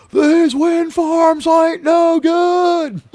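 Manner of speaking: high, drawn-out hammed-up holler; mock scream. Landman screaming into her ear at the windmill, hammed-up yell. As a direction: scream it slowly, stretching every word. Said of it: "he has to scream into her ear".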